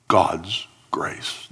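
ordinary talk

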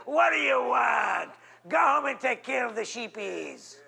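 put-on older brothers jeering at David, shouted slow; mocking brothers. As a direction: bark it down at the kid brother. Brothers scolding David, acted.

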